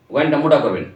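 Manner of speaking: ordinary delivery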